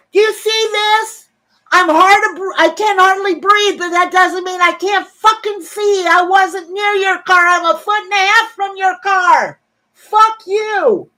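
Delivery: high-pitched, strained yelling rant; re-enacted furious outburst; retold angry scream. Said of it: "And I screamed out at her".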